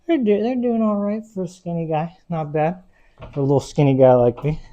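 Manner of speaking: self-mocking high tone; joking high voice. Real, not acted. Joking about skinny arms.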